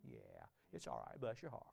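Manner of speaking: low, soft, sweet-talking devil; soothing devil. Retold or imitated. Preacher voicing the devil soothing a stubborn believer, low and soft. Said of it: "And devil's like".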